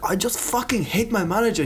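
high mock voice of a complaining girl; imitating young Polish woman. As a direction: whine it like a fed-up young woman. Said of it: "they were like".

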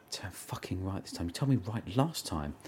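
quiet grumble to himself; muttered under breath. Retold or imitated.

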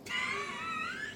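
sudden high-pitched burst of laughter; high squeal of laughter. Real, not acted. [name] laughing at Nate's empty rewards, high squeal. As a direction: shriek with laughter at the partner.

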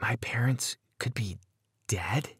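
hushed, fearful whisper; soft, whispered disbelief; frightened whisper. A narrator's reading. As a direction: whisper it in dawning horror. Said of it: "I whispered with a trembling lip".

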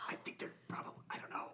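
hushed, unsure whisper. Real, not acted. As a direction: trail along in a whisper. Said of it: "Why are we whispering?".

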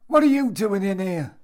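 high, loud challenge from behind; demanding shout. A narrator's reading. Voice behind Jimmy catches him in the storeroom, demanding shout. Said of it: "The voice demanded again".

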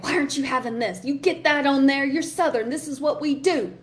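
Southern grandma pushing food on her granddaughter, mock-bossy; bossy Southern grandmother. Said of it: "Like, so bossy".

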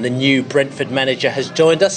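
ordinary talk

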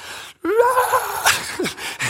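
put-on high wailing baby cry; imitated baby cry. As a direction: wail like a crying infant. Baby son crying. (etterlignet babygråt)

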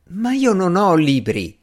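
high-pitched, frustrated protest; exasperated exclamation. A narrator's reading. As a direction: burst out in exasperation. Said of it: "esclamò Strange". (esclamazione esasperata, su tono alto)

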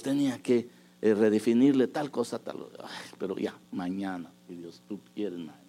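usual speaking voice

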